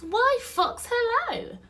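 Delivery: very high, loud piping greeting; breezy mouse. Acted. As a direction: greet an acquaintance in a tiny mouse voice.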